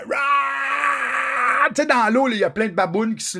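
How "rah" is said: long drawn-out roar of pain; a slow, hoarse scream of grief; underwater scream. Retold or imitated. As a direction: let out a slow anguished roar. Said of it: "En plongeant, là, je fais".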